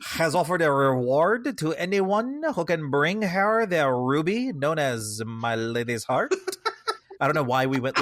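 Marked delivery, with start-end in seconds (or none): 0.1-6.3 put-on slow, weird Swedish-French hybrid accent; over-the-top French accent. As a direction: draw it out in a terrible French accent. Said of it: "like, super French all of a sudden".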